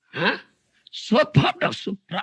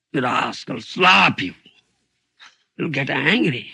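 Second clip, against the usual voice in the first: ordinary talk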